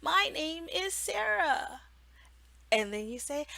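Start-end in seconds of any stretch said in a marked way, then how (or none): 0.0-1.8 a hint of a child, slow and higher; lightly voiced child. Mock mentor playing a child, lightly voiced. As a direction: answer like a young student. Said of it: "she goes".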